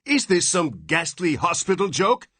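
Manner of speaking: high, furious demand; enraged. A narrator's reading. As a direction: rage through the terror. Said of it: "his terror resolving into rage".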